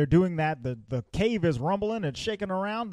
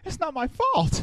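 high mock protest; 14 semitones higher than usual. Quoting Han's excuse to friends, high mock protest. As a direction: voice jumps way up in mock indignation.

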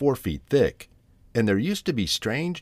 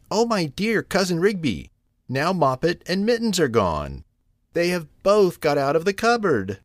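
high-pitched storybook dismay; distressed mother cat; 10 semitones higher than usual. Acted. Tabitha finds both kittens gone, high and distressed. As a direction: discover the loss and lament it.

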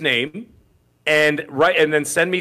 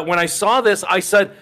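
normal talking voice

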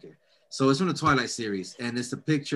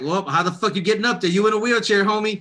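louder, higher mocking jab; mock taunt; 6 semitones higher, 5 dB louder than usual. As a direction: taunt him like a schoolyard bully. Mocking a dead man in heaven.

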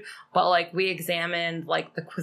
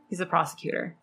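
ordinary talk

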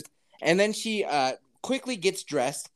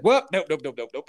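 retold hasty refusal; 4 semitones lower, 3 dB louder than usual. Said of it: "And he's like".

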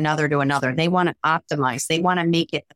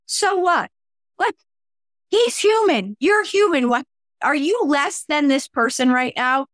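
incredulous; 6 semitones higher than usual. Retold or imitated. Scolding a starstruck staffer.

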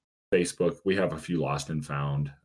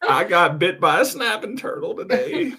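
put-on high, laughing old man host; 13 semitones higher, 5 dB louder than usual. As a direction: open the show as an old man. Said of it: "you could just use your regular voice".

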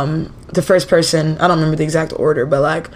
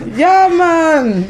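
hammed-up Jamaican-style Rasta Yeah, man, higher and louder; exaggerated Rasta accent; 11 semitones higher, 4 dB louder than usual. Imitating a Rasta woman for friends, an overdone Jamaican-style Rasta accent. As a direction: go big on the Rasta accent, laughing at yourself. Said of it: "it's not correct".

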